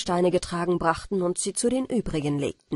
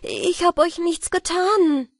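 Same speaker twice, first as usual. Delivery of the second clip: put-on much higher and louder, a scared boy calling out; frightened cry; 11 semitones higher, 6 dB louder, slower than usual. A narrator's reading. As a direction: cry out in alarm, voice jumping up. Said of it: "rief ich".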